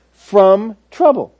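drawn out slowly, "from trouble"; emphatic phrase. Shown as a demonstration. Preacher to congregation, slowly stressing "from trouble". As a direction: linger on "from trouble" to drive it home.

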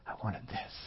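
almost whispered, dropping to a breath; quiet aside. Retold or imitated. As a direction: let the words fall away to a whisper. Quoting own wanted order.

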